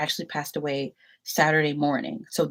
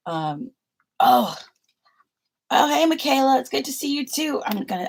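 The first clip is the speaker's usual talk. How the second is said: usual speaking voice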